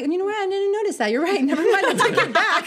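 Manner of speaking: high, laughing take-back; snatch the compliment back, giggling; mock retraction, laughing. Teasing a self-doubter, high giggly mock retraction. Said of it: "You're like".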